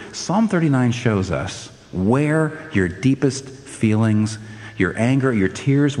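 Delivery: ordinary delivery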